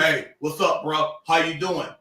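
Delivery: deep voice. Shown as a demonstration. Mocking the demand to talk like a man, deep voice. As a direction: drop the voice low for a bro greeting. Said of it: "deep voice".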